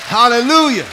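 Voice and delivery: shouted praise; loud hallelujah. Real, not acted. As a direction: call it out to the church.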